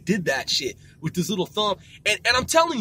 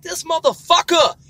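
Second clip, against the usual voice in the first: amused exclamation; 5 semitones higher, 7 dB louder than usual. Retold or imitated.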